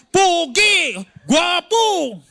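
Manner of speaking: high cheering of Pogi and Gwapo; campaign cheer. Retold or imitated. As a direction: yell it like a crowd at a rally. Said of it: "sigaw nyo".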